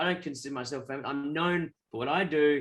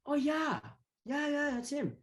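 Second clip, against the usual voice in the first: high-pitched imitation of a friend; friend's recognition; 11 semitones higher, 3 dB quieter, slower than usual. Friend suddenly recognizing the star.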